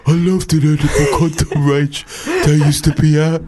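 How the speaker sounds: steady hammed-up emailer voice, slowing at the end; Roy. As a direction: read the email as an odd little man. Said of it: "I'll just do it in Roy's voice".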